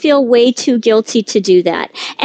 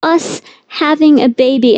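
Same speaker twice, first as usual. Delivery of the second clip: higher-pitched, mildly anguished complaint; lightly acted wife's lament, pitched up; retold exasperated plea; 5 semitones higher than usual. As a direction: retell an exasperated appeal to a husband. Retelling her plea to husband.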